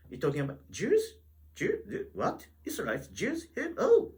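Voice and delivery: high, hammed-up puzzled reader; mock confusion. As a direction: play the baffled reader, way up high. Acting a confused Bible reader.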